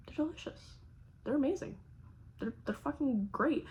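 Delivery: soft, high, gentle praise; sweet reassurance. Retold or imitated. Boyfriend praising her disgusting cookies, sweet soft reassurance. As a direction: coo it softly, kindly fibbing.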